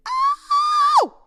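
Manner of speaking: very high, loud "Oh!"; piercing cry of disbelief; retold outraged cry. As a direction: shriek it in mock outrage. Retold outrage at his laughing.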